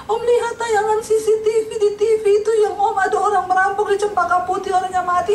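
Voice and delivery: high, tear-choked retelling; tearful telling. Retold or imitated. Robber telling his uncle about the killing, weeping and high. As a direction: blurt it through tears. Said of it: "Nangis dia di rumah omnya".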